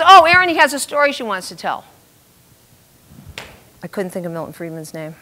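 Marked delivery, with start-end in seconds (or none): 0.0-1.8 loud, high-pitched mimicking of the host; mock announcement. Radio host exposing guest's story, loud and high. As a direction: announce it loudly at her expense.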